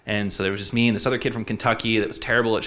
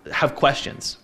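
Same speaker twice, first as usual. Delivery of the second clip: ordinary delivery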